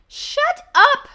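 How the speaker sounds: very high, very loud scream; furious scream. Acted. Toad screaming at the strange voice. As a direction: scream to silence the voice. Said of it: "screamed Toad".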